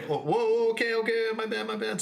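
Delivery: high-pitched, placating retreat; hammed-up nervous apology; mock alarmed backing down. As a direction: back off fast in a high voice. Retold backing down from the bouncer.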